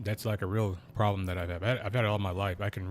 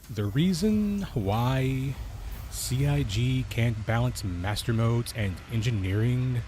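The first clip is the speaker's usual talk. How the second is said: slow, drawn-out YouTuber intro voice; melodramatic YouTuber; 3 dB louder, much slower than usual. Shown as a demonstration. Streamer mocking a scripted video intro, melodramatic YouTuber voice. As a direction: stretch it out like an overblown video narrator. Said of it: "a melodramatic, like, YouTuber voice".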